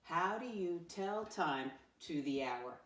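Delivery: slow read-aloud for a class to join; read-along. Shown as a demonstration. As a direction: lead them through it slowly. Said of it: "Read it with me this time".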